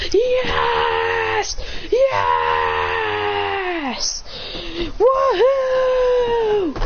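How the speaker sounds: high, drawn-out victory screams; mock triumphant cheer. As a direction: cheer like a gamer who just won. Celebrating beating the level.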